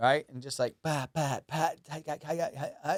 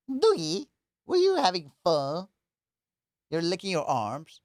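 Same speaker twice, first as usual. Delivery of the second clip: louder, higher pet-talk voice; goofy high baby talk to the dog; Doogie voice; 5 semitones higher, 4 dB louder than usual. Acted. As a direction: call to the dog in a silly high voice. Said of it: "That's how I talk to Doogie".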